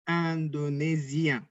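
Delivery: "Indonésien" slowly, with a nasal "in" like "an"; French pronunciation. Shown as a demonstration. Teacher models "Indonésien" slowly, not the Indonesian way. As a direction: stretch out "Indonésien" with a nasal opening. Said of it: "kita terbiasa untuk bilang Indonesia".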